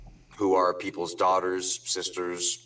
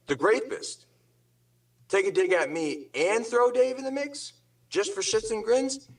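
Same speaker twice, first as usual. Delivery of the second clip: pitched way up in disbelief; rising outrage, can't believe it; incredulous, voice climbing high; 11 semitones higher than usual. Real, not acted. Incredulous rant.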